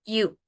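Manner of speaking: "You" said as "iu", an i glide into u; pronunciation of "You". Shown as a demonstration. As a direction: say "You" like Spanish i-u. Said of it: "se pronuncia I U".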